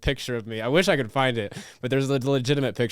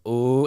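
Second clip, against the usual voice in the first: drawn-out, louder taunting crowd "oooh"; class "ooh"; 6 dB louder than usual. Retold or imitated. Imitating the class as he gets called out, teasing. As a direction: imitate classmates ooh-ing at someone in trouble. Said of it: "everybody goes".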